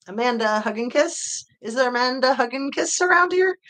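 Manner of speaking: mock bar page, pitch climbing as it goes; calling out a name. Streamer re-enacting a Simpsons prank call, calling out the name. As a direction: call out questioningly for someone in the bar. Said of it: "when Bart calls into the bar".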